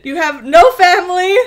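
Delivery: high, loud mock jab; mock insulting outburst. Joining Kylo's insulting confession, mock outburst. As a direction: pile on with play-acted outrage. Joins the acted insult rant.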